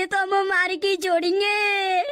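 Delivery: slow, crying lament; wailing. Acted. Prisoner realising the beating will not stop, wailing. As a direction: stretch it out into a hammed-up sob. Victim wails about the beating.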